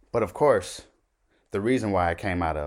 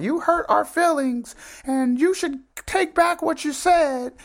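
very high, squeaky mock complaint; mocking wife; 4 dB louder than usual. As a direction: imitate an aggrieved wife in falsetto. Mocks owner's wife's emails.

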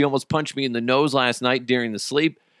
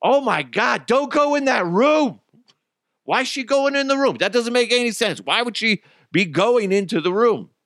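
yelling at screen; 11 semitones higher than usual. Retold or imitated. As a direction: shout warnings at the movie. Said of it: "be like".

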